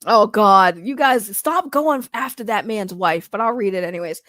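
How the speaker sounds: ordinary delivery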